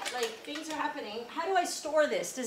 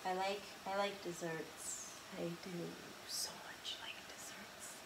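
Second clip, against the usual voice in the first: very soft, fading into a whisper; hushed confession; 5 semitones lower, 12 dB quieter than usual. Real, not acted. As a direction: murmur it low, almost to yourself. Trailing confession of loving desserts.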